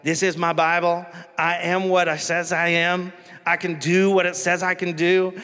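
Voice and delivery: horrible Southern preacher impression; Joel Osteen. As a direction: recite it like a Southern televangelist's creed. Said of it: "I do a horrible Southern accent".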